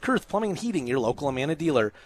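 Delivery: brisk announcer reading the sponsor list over music; sponsor roll call. Acted. Broadcaster thanking local sponsors, upbeat announcer read. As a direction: rattle off each sponsor like a radio announcer.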